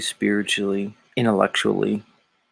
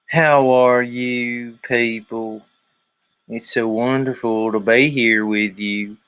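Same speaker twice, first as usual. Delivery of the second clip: very slow, drawn-out Southern preacher greeting; hammed-up televangelist with stretched Southern vowels; Joel Osteen; slower than usual. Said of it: "Joel Osteen".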